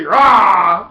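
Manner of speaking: loud roaring shout. Shown as a demonstration. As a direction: roar it out like a lion. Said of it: "in order to say".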